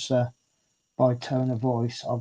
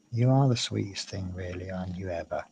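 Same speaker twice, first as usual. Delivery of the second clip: tender pet talk; 5 semitones lower, 6 dB quieter than usual. Real, not acted. Cooing at cat.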